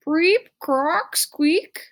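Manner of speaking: high rising froggy preep, croak, squeak; frog calls. Retold or imitated. Stink practising frog calls for his friends. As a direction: chirp them out like little frogs. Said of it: "frog sounds repeated".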